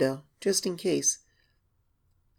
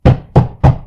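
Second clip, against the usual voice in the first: scream; 18 dB louder than usual. Acted.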